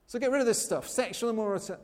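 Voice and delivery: normal talking voice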